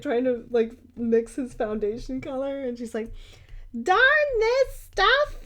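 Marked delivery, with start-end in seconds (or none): 3.8-5.4 very high, loud frustrated mock cry; hammed-up movie quote, pitched way up; Edward Scissorhands mom. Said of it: "that scene from Edward Scissorhands".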